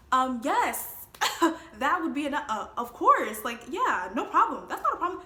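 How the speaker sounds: bursting with delight at the offer; excited. Retold or imitated. Excited accepting job offer.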